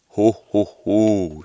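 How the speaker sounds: very loud, hearty ho ho ho; proud full-voiced Santa laugh; booming Santa laugh. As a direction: boom it to greet the lucky person. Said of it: "sagde Nikolaj".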